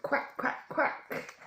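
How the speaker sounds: unvoiced quacking; playful duck quack. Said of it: "A duck can".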